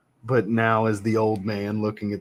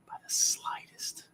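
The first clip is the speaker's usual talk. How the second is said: breathy whispered aside, much softer; 9 dB quieter, slower than usual. Real, not acted. Streamer confirming his fragrance pick to himself, whispered. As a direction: murmur it under your breath.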